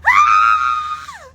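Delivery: piercing high loud scream. Retold or imitated. Patients' staff staring as she screams, piercing high scream. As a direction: shriek in pain. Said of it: "I'm screaming like".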